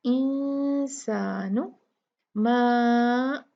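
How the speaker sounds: इंसानु nasal then मा stretched four counts; slow, hold मा long; tajweed recitation; light ghunna, long ma. Shown as a demonstration. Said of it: "चार count खींचा जाएगा".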